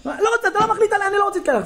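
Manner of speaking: high, fast mock child outburst; verbal attack. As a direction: lash back like a kid refusing orders. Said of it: "והוא תוקף אותי, תוקף כאילו מילולית". (ילד מתפרץ בהתנגדות, בקול גבוה)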